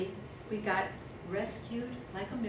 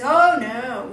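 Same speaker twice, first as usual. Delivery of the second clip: loud hunter; 2 semitones higher, 13 dB louder than usual. Retold or imitated. Hunter voiced in the story.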